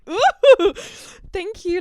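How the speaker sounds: loud, very high mock squeal; startled flinch. Recoiling from a drunk date's hand, startled high squeal. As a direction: flinch into a polite, high-pitched thanks. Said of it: "flinched. I was like".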